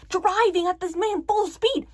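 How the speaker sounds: ordinary talk, nothing marked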